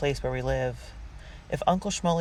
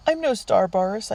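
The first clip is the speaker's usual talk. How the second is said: high, loud tearful protest; blubbering; 7 semitones higher, 4 dB louder than usual. A narrator's reading. Young actress protesting Boris's praise, blubbering. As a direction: blubber it out, pitched way up. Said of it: "I blubber some more".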